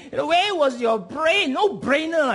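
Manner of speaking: high-pitched, exasperated telling-off; mock scolding. Stranger berates a thoughtless driver, mildly mock scolding.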